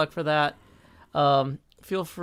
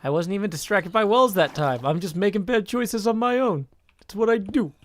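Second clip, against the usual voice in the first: a lightly put-on folksy cartoon-bear voice; Yogi Berra impression. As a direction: play the lovable bumbling bear. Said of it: "That's an impersonation of famous".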